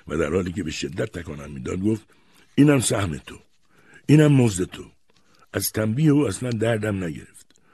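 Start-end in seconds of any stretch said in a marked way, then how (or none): 2.6-4.9 loud, raised-pitch scolding. A narrator's reading. Grandmother shakes the boy by his hair, scolding. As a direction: punch out each half as punishment.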